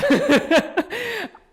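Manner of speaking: high-pitched burst of laughter. Real, not acted. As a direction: laugh at yourself. Laughing at himself.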